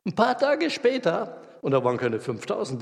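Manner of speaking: ordinary delivery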